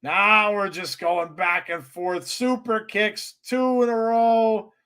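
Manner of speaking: excited high play-by-play call; wrestling commentator. Acted. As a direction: call the match live like a ringside announcer.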